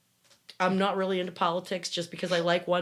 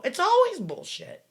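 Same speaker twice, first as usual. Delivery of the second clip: high, loud burst on "always"; exasperated outburst; 13 semitones higher, 6 dB louder than usual. Real, not acted. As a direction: blurt it out, fed up with the rules. Exasperated at the Sabbath rules.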